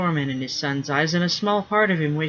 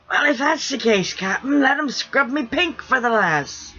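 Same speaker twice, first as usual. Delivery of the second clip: higher, put-on rough sailor voice, hurt; wounded pirate rigger; 9 semitones higher, 3 dB louder than usual. Rigger protesting to his friend about the bath, aggrieved. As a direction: plead like a betrayed old salt. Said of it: "Sniff called back".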